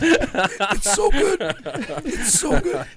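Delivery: high, slow, pained laugh; strained laughing. Real, not acted. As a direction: laugh through the burn. Burning from spicy salad.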